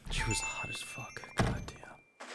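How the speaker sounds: whispered admiration; lustful whisper. Real, not acted. Gushing about a hot older coworker, lustful whisper. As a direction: drop into an appreciative whisper.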